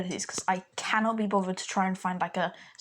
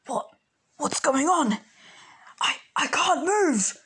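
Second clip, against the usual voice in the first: panicked villain; 8 semitones higher, 3 dB louder, slower than usual. Acted. Villain frozen in place.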